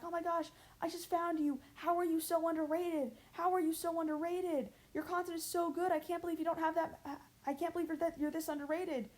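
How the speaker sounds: high-pitched gushing commenter; gushing fan. Retold or imitated. A new fan in the comments gushing over the channel. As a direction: gush like an excited new viewer. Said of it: "people in my comments being like".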